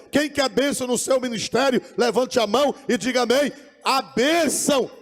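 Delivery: loud, high-pitched pulpit exhortation; full-force preaching. Real, not acted. Preacher urging the congregation to raise hands for blessing, full-force preaching.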